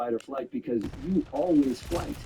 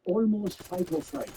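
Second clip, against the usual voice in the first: slow teasing repeat; 7 semitones higher, slower than usual. Retold or imitated. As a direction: slowly savor Almost fight or fright, fright drawn out.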